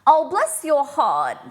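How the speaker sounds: mock Southern pity for the simple-minded; pitying "bless your heart". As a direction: drip fake sympathy slowly. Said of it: "saying someone is simple is bless your heart".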